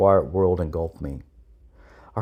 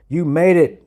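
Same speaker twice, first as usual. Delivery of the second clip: loud, higher-pitched sergeant's call; raised-voice congratulation; 9 semitones higher, 6 dB louder than usual. A narrator's reading. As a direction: call it out to a recruit who just finished. Sergeant calls to rescued recruit.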